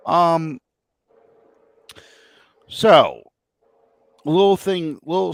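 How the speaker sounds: sudden hiccup jolts; hiccuping mid sentence. Real, not acted. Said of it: "I have the hiccups".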